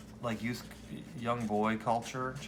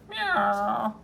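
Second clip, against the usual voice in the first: cat meow; 9 semitones higher than usual. Acted. Cat voiced while reading comic.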